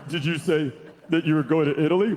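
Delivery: low, tight voice holding in a burp; strained voice. Retold or imitated. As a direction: talk while suppressing a burp. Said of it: "And then I'm like".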